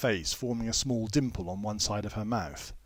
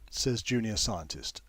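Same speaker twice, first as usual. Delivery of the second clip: usual speaking voice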